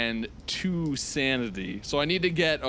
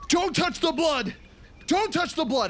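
high-pitched strained shriek of warning; panicked scream. Acted. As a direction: scream it out in horror, far above your usual voice. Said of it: "and he just screams".

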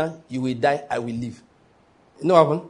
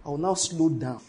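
ordinary talk, nothing marked

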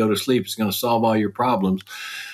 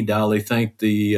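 normal talking voice

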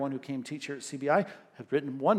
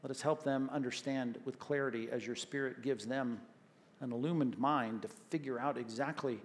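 soft, earnest prayer voice; closing prayer; 4 semitones lower, 8 dB quieter than usual. Acted. Pastor praying for his church, soft and earnest. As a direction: pray quietly over the congregation.

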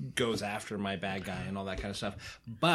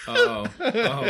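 high laughing oh, loud; 10 semitones higher, 8 dB louder than usual. Real, not acted. Embarrassed laughing after an awkward mix-up.

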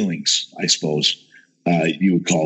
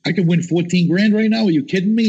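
excited disbelief; 8 semitones higher than usual. Retold or imitated. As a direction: voice jumps up as the prize sinks in. Realizing he could win big.

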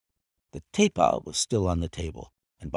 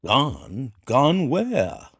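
startled; 5 semitones higher, 4 dB louder, much slower than usual. A narrator's reading. Master startled by news.

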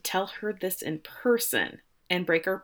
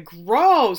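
loud mock scream of disgust; kids' scream imitated; 3 semitones higher, 10 dB louder than usual. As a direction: shout it out like grossed-out kids. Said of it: "'cause my kids scream".

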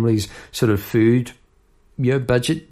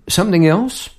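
blunt flat demand; 7 semitones higher, 5 dB louder than usual. Retold or imitated. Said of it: "is it the delivery of the sentence? It sounds more like a statement".

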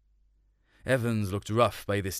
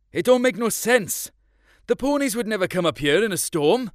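put-on high, loud young lad; boy's voice; 10 semitones higher, 6 dB louder than usual. A narrator's reading. Son doubting his father on the mountain, high boyish voice. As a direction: voice a worried farm boy. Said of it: "I said".

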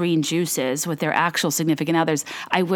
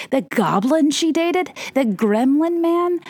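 higher, slow, disgusted drawl; worked up; 8 semitones higher, slower than usual. Real, not acted. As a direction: stretch out the creepy words, getting worked up. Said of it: "I'm getting worked up again".

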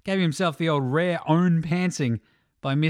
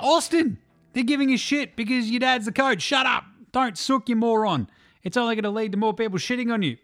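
higher, insistent telling-off; fed-up, high-pitched rebuke; exasperated scolding; 6 semitones higher than usual. Acted. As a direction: scold a sulking player. Scolding the player directly.

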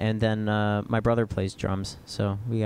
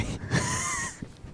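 shrill burst of laughter; high squealing laugh; 7 dB quieter than usual. Real, not acted. Laughing at Cajun bit.

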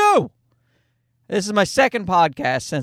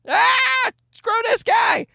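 put-on a high, far-off shrieking baby voice, much higher and shouted; baby screaming; slower than usual. Dad voices his screaming baby who hates him, high shout. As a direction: squeal it out like an outraged newborn. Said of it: "he starts screaming".

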